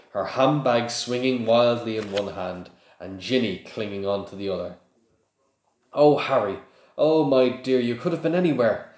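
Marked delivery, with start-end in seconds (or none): 5.9-8.9 higher, louder anxious fussing; motherly fretting. A narrator's reading. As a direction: fuss over a lost child. Worried mother finds the lost boy.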